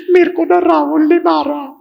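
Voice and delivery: high, loud mock child sob; crying child. Little boy crying to his mother, high mock sob. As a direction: wail like a hurt little boy.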